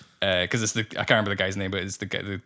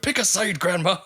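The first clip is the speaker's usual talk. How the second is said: high, raised-voice imitation of a raging yell; Bill Murray's shout; 9 semitones higher, 4 dB louder than usual. Quoting a man raging out of the studio, high mock shout. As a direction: shout it as a mock outburst, pitched right up. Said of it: "you just hear him shout".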